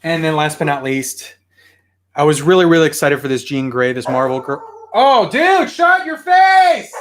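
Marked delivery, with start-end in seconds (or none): 4.9-6.9 high, loud, drawn-out shout; shouting at the dog. Real, not acted. Owner yelling again at the barking dog, full shout.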